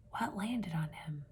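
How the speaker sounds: a hushed whisper after a sharp gasp. Acted. Teacher hushing the kids in mock suspense, gasped whisper. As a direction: whisper it like a shocked secret.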